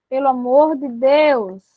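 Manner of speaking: normal talking voice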